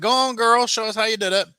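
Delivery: hammed-up taunt, daring her; sassy cop. Retelling the cop pushing the girl to demonstrate, high hammed-up sass. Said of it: "the cop's like".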